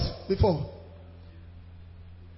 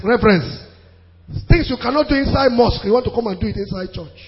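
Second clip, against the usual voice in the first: normal talking voice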